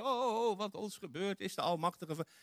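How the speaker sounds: mild mock wail to God; Joshua crying out. Joshua on his face before God, mildly wailing. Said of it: "begint het uit te roepen naar God".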